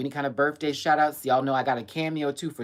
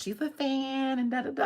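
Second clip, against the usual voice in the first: high mother voice; 12 semitones higher than usual. Retold or imitated. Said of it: "her mother ... was like".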